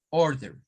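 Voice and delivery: American-style order; rhotic order. Shown as a demonstration. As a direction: order with a tapped R. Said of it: "para una orden".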